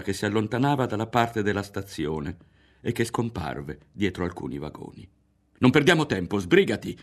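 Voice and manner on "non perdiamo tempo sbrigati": loud hurried call; urgent shout. A narrator's reading. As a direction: push him to move now. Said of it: "gridò Berto". (grido urgente, di fretta)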